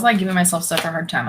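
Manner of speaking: normal talking voice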